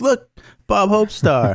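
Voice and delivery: put-on high, drawn-out drunken exclamation; drunk and excited. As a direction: act out a drunk, excited star-spotter calling out slowly. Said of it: "like, drunk and being like".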